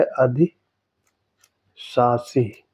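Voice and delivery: अधिशासी drawn out very slowly, syllable by syllable; teacher stretching अधिशासी out; slow careful demo. Shown as a demonstration. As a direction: spell out अधिशासी slowly for the class. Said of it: "अधिशासी होता है".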